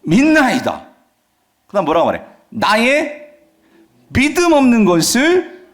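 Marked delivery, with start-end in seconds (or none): 0.0-0.9 high, emphatic acted declaration; reenacted outcry of belief; sudden crying out. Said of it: "갑자기 소리를 지르면서 뭐라고 말하냐면". 2.5-5.7 high, drawn-out acted plea; a father's outcry read from scripture; crying out. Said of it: "갑자기 소리를 지르면서 뭐라고 말하냐면".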